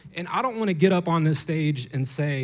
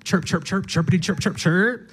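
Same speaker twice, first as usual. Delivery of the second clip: higher chirpy bird voice; bird chirping; 5 semitones higher, 4 dB louder than usual. Acted. Preacher imitating a freed bird, light higher chirping. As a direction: chirp it out happily. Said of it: "the bird starts to chirp".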